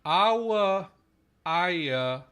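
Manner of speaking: loud, drawn-out demo of "awa, aya"; full vowel sequences. Shown as a demonstration. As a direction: sound out "awa" and "aya" as two syllables each. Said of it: "going to ah and aw".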